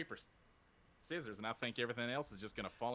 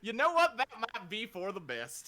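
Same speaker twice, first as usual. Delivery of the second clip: sudden excited outburst; 9 semitones higher, 11 dB louder than usual. Real, not acted. Sudden decision to set up.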